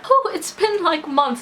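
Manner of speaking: choking up mid-sentence, real tears close; getting emotional. Real, not acted. Retelling the talk with Dad to viewers, choking up. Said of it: "I don't know why I'm getting emotional".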